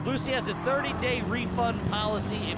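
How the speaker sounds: upbeat sponsor read. Acted. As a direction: read it like a podcast ad. Podcast host ad read.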